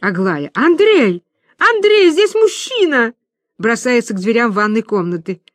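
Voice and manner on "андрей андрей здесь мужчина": high panicked scream for help. Acted. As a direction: shriek his name in terror. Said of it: "испускает пронзительный вопль".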